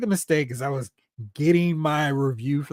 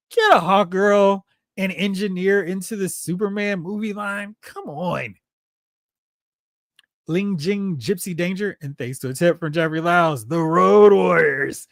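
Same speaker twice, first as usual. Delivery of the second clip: usual speaking voice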